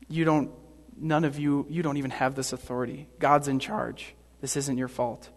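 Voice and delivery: soft, settled, absolving tone; quiet reassurance. Acted. Jesus telling Pilate God's in charge, softly reassuring. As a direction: lift the blame in a hushed, gentle voice.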